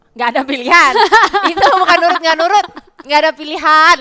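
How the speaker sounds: high, loud laughing protest; loud amused exclamation. Real, not acted. Laughing exclamation about no choice.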